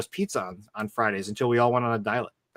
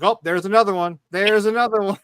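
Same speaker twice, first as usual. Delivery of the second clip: teasing; 7 semitones higher, 4 dB louder than usual. Retold or imitated. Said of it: "we were just fucking with her".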